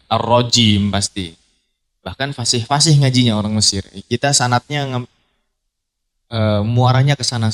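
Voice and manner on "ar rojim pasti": "Ar-rojim" slowly with a clear J sound; careful recitation pronunciation of "Ar-rojim"; slow correct jim; proper Quranic jim. Shown as a demonstration. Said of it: "kalau ngaji ya nggak ada rogim".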